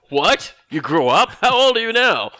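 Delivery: mock-baffled father, rising high and loud; mock astonishment. Father not knowing his daughter, mock astonished questioning. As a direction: play a clueless dad's shock, climbing in pitch.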